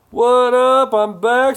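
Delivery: high, loud chat read-out; loud, high-pitched announcement; mock shouted greeting. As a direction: holler it like a big entrance. Reads out a viewer's greeting.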